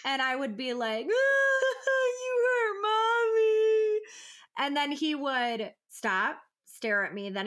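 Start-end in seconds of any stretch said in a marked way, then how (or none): 1.1-4.0 high, loud, slow fake sob; fake crying. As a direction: put on a hammed-up cry to guilt the baby. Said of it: "So I would fake cry at him".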